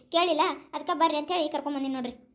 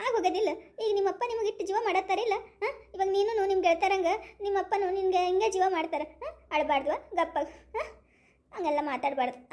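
ordinary talk, nothing marked

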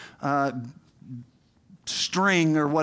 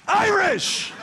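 high-pitched incredulous outburst; mock outrage; 12 semitones higher than usual. Pastor repeating his staff's verdict on his accent, mock outraged. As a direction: cry it out in hammed-up indignation.

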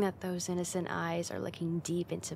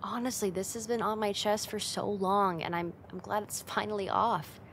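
ordinary talk

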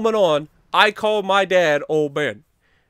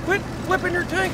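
exasperated call; 10 semitones higher than usual. Real, not acted. Scolding teammate during match.

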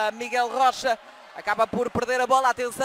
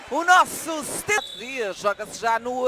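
usual speaking voice

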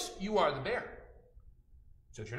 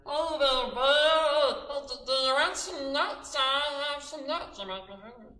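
high, loud squirrel calling down; 10 semitones higher, 8 dB louder, slower than usual. Acted. Squirrel offers nuts to the bear from the treetop. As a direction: call down from the tree like a squirrel. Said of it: "and he's looking down".

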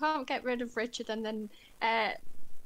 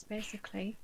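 whispered aside; 8 dB quieter, faster than usual. Real, not acted. Aside after pain in the arse.

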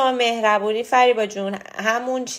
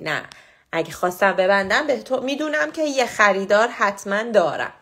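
ordinary talk, nothing marked

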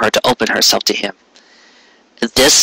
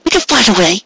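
sniffling; 10 semitones higher, faster than usual. A narrator's reading. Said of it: "she said, sniffling".

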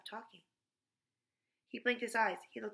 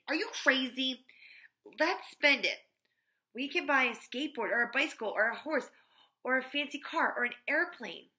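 loud, higher, eager urging; excited exclamation; 4 semitones higher, 7 dB louder than usual. A narrator's reading. Joy urges her friend to spend the money, excited exclamation. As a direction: push the idea with loud, mounting excitement. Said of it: "Joy exclaimed".

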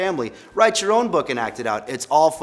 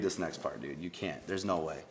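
muttered refusal; 7 semitones lower, 14 dB quieter than usual. Real, not acted. Quiet refusal.

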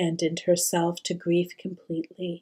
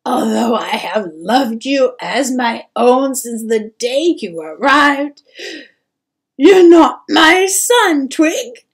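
sobbing confession, building louder and higher; loud, anguished, weeping mother; tearful; 4 semitones higher, 10 dB louder than usual. A narrator's reading. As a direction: break down while telling a painful truth. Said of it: "looked at the boy tearfully".

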